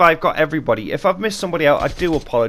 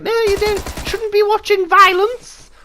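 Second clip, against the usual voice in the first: very high squeaky mum voice, slowing at the end; mock strict mum; 6 dB louder than usual. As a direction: squeak it out like a fussy mum scolding. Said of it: "She was like".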